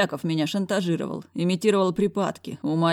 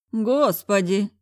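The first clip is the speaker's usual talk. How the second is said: groaning director; 5 semitones higher, 4 dB louder, much slower than usual. A narrator's reading. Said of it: "простонал Яков Менелаевич".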